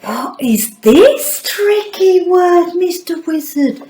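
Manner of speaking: high, slow, amazed wonder; mock astonishment at the word; amazed question. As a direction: ask it full of wonder, drawn out. Reveals the word in the bag.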